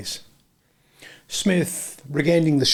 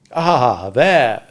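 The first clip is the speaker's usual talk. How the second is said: manly regret; 4 dB louder than usual. A narrator's reading. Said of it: "a touch of manly regret in his voice".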